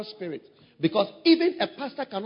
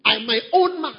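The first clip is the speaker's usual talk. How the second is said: mock proud independence; 4 semitones higher, 5 dB louder than usual.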